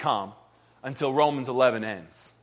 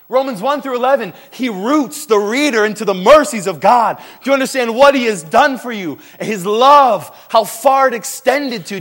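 louder, driving fervent preaching; preaching fervently; 7 semitones higher, 10 dB louder than usual. Real, not acted. Preacher urging the congregation, fervent and loud. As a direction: press the point home to the congregation.

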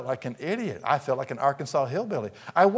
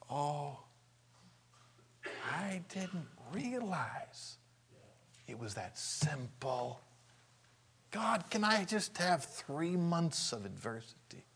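soft, slow, much quieter awe; a soul in heaven quietly asking God for more time; wistful regret; 3 semitones higher, 9 dB quieter, slower than usual. Acted. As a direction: marvel quietly at how simple it was. Believer looking back from heaven.

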